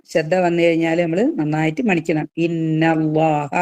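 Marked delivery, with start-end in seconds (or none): 2.4-3.5 slow, drawn-out recited phrase; slow Quranic chant; Quran recitation. Shown as a demonstration. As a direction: stretch it in tajweed style. Recites verse, demonstrating madd.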